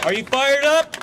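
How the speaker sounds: crowd-rousing cry to union workers; rally shout. Real, not acted. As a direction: fire up the crowd with a shouted question.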